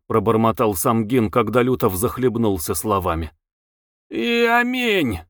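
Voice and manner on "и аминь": high, long-drawn exclamation; drawn-out high cry of finality. A narrator's reading. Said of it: "крикнул Лютов".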